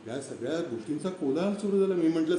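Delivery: plain reading voice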